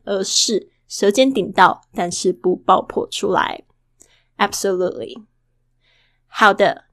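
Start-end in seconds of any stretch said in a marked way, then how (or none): none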